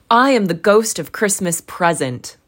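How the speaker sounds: loud, proud ghost announcing himself; grand spirit. A narrator's reading. Ghost introducing himself to Scrooge, loud and grand. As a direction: declare your name like a jolly giant. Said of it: "said the spirit".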